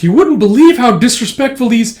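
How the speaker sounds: high, loud acted complaint; indignant tattling. Complaining to the chairwoman about disrespect, indignant. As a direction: tattle in a fluster to the boss.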